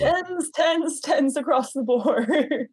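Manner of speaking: imitating a Drag Race judge calling tens across the board; Drag Race judge catchphrase. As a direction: deliver it like a TV judge scoring the runway. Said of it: "Which is a Drag Race reference".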